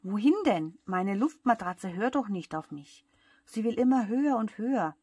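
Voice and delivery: loud, agitated complaint; helpless outburst, pitch swooping up; desperate protest. A narrator's reading. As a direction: protest at full voice, exasperated. Pitt protests in distress.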